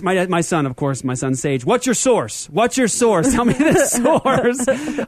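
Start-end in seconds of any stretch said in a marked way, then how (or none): 1.7-5.0 son's voice. Retold or imitated. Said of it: "He says".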